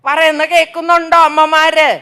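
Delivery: ordinary talk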